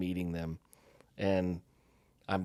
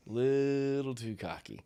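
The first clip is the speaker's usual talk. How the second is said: drawn out, a touch higher; slow emphasis; 6 semitones higher, slower than usual. Shown as a demonstration. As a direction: stretch it out to make the point.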